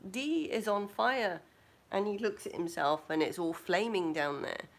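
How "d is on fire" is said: high, urgent servant cry of master; a frantic servant alerting his master; voiced panicky servant. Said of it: "the servant looks at him and goes".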